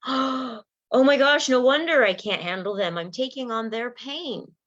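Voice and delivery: high, loud gasping oh my gosh; suddenly realizing what you've been doing; shocked realization. Pretend. Listener realizing they absorb pain, gasping aloud. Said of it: "And you might go".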